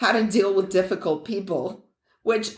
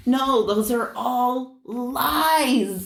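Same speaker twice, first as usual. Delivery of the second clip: slow, drawn-out emphatic debunking; exasperated; 3 semitones higher, much slower than usual. Real, not acted. Debunking trickle-down promises to a friend, exasperated. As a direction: stretch the words out in exasperation.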